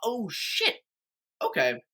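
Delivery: high startled mock curse, then deflate; hearing the fracture news, a high startled curse; retold dismay at the doctor's news, high then dropping; dismayed shock. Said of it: "And I was like".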